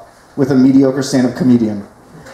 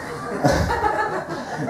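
high giggle; 10 dB quieter than usual. Real, not acted. Laughs at own setup.

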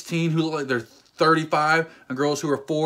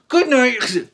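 loud, higher knockout call; fighter's taunt; 10 semitones higher, 8 dB louder than usual. Pretend. Imagining knocking out a kid, loud gleeful taunt. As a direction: announce the knockout with glee.

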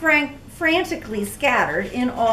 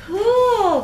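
high, loud cry; excited cry; 10 semitones higher, 5 dB louder than usual. Acted. Clara marvels at the new butterflies with a high, loud cry. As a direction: gasp it out in wonder. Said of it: "cried Clara".

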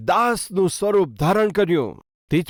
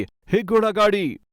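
retold call out; 5 semitones higher, slower than usual. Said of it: "તેમણે જોરથી બૂમ પાડી".

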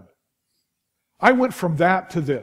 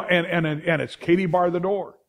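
normal talking voice

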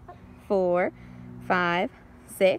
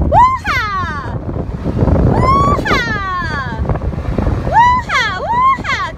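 loud whooping cheer, much higher; rally the kids with a whoop; 10 semitones higher, 14 dB louder, much slower than usual. Pretend. Cheering with children.

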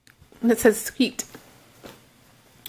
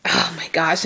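ordinary talk

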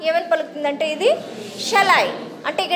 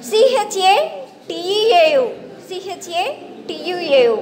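letters spelled out one by one, slowly; slow spelling out; much slower than usual. Shown as a demonstration. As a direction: read the letters slowly for the class. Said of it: "షెట్యు".

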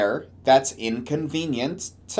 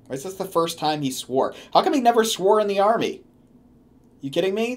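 ordinary talk, nothing marked

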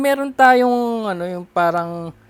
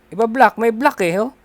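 ordinary delivery

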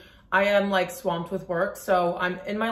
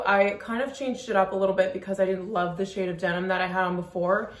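normal talking voice

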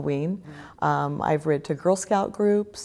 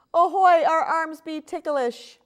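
much higher, giggly little pirate; giggling; 12 semitones higher, 4 dB louder, slower than usual. Acted. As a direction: squeal it like a ticklish kid. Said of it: "giggle her little pirates".

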